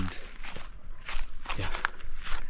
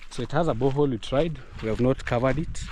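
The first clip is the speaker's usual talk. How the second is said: ordinary delivery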